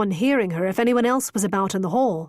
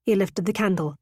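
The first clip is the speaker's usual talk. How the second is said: plain reading voice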